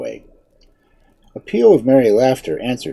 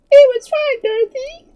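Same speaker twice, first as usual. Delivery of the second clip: tiny, very high piglet voice; 7 dB louder than usual. A narrator's reading. Piglet reassuring the girl, squeaky and high. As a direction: squeak it out, starting loud.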